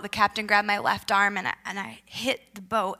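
usual speaking voice

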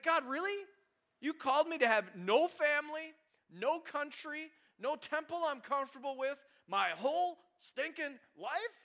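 pleading, put-upon protest, stretching "my whole stinking life"; exasperated complaint to God. Retold or imitated. Believer whining to God about a lifelong calling, high exasperated protest. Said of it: "that's how we talk".

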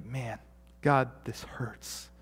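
whispered, slow, hurting prayer. Retold or imitated. A hurting believer whispers to God. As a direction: breathe it out like a hurting plea.

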